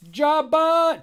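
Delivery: loud high shout of a name; calling out. Acted. Jammer calls Jobbot into the fight, loud high shout. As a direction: yell for the robot to come.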